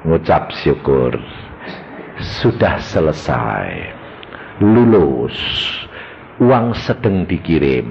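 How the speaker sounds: low, slow and solemn, calmly announcing; voice of God. Retold or imitated. God answering the worried preacher, low and slow. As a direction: lay each word down slowly as God. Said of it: "Tuhan ini ngomong apa?".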